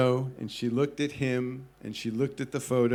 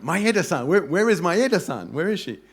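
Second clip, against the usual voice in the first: eager excitement; 5 semitones higher, 6 dB louder than usual. Retold or imitated. Ears perked up, eager search.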